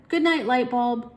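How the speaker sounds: put-on slow, high, loud little boy; child voice. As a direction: say goodnight like a small child. Child saying goodnight.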